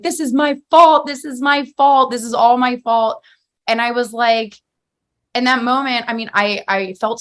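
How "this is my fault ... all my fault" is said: retold upset outburst in a higher voice; mildly acted cry of self-blame, pitched up; imitating tearful yelling. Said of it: "started crying and, like, kinda yelling at me".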